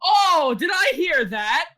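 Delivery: loud, high, drawn-out question; excited outburst. Real, not acted. Player blurts out an excited question.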